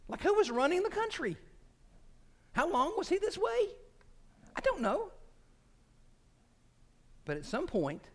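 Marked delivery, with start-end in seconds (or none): 0.1-5.1 baffled high questions. Real, not acted. Preacher wondering who ran the country, squeaky bafflement. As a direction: pitch way up, incredulous.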